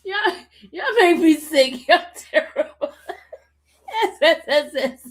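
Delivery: mock complaint through real laughter; words wobbling with helpless laughing; crying with laughter. Real, not acted. Streamer scolding y'all in the chat, crying with laughter. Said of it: "They got me crying over here".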